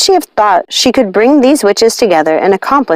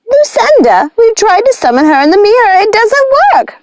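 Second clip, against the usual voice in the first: yelling; 14 semitones higher than usual. A narrator's reading. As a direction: warn Lucinda it won't work. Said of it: "yelled Ruby".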